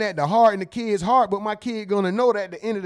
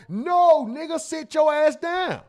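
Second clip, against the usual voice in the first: scolding shout; 7 semitones higher, slower than usual. Retold or imitated. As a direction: bark it with the same cheering energy. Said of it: "Same enthusiasm".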